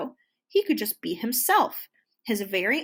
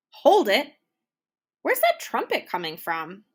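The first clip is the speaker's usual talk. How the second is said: booming band leader; 6 dB louder than usual. A narrator's reading. Band leader booms at unseen trumpeter, loud. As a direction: boom out a stop to the music. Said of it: "boomed the band leader".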